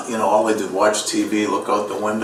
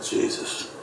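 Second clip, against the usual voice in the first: ordinary talk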